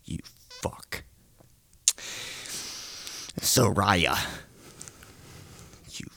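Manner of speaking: ordinary delivery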